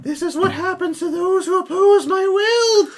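very high, slow proclamation; menacing otherworldly voice. Acted. Player in character as the victims die, high menacing proclamation. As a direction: pronounce punishment in a high eerie wail.

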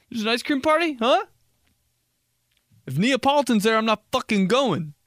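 high-pitched refusal; mock indignant guest. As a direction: refuse the invite in comic outrage. Plays indignant guest at ice cream party.

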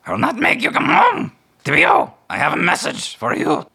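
high, loud bird voice delivering news; raven messenger. Acted. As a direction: croak it out like an urgent raven. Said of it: "flaps down close to you and says".

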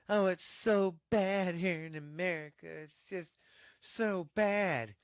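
high mocking whine of complainers; whiny complainer. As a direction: sneer it like a spoiled whiner. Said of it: "People bitch and bitch".

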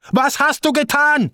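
high, sharp accusing relative voice. Retold or imitated. Relative on the phone blaming him, high and accusing. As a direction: fling the question like an angry relative.

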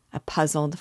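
ordinary delivery

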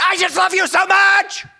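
high, loud, slow shouted love; mock yell. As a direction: yell it like an angry parent. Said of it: "When have you ever yelled at your kid".

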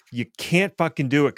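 ordinary delivery

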